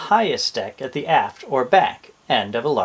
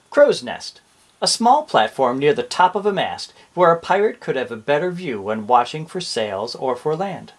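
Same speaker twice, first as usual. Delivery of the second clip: usual speaking voice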